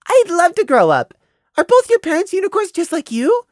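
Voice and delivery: very high, loud-edged little voice; eager child. Acted. Prey needling the mare about parents, eager child voice. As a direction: gush with innocent curiosity.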